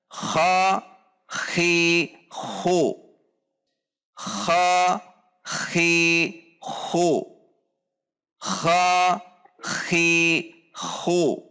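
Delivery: "Kha, khi, hu" with a rasping throat kh, each syllable clipped apart; Arabic kha demo. Shown as a demonstration. Teacher drilling "Kha, khi, hu" for students, throaty kh. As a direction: rasp out the throat kh on every syllable.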